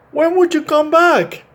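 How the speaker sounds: put-on little boy asks his friend the horse; Kenny asking. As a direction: lift it way up like a small boy asking. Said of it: "Kenny asks".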